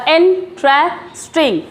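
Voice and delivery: इंटरेस्टिंग drawn out slowly to count syllables; slow syllable demo of इंटरेस्टिंग. Shown as a demonstration. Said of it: "बोलने की practice करें".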